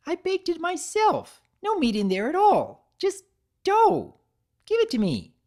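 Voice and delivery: very high, loud, falsely bright; a creepy grin in the voice; eerie cheerful Pinkie. Acted. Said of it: "something off about that grin".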